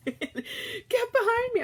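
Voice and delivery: a stretched, laughing alarmed yell; husband's warning yell. Retold or imitated. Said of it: "I hear him".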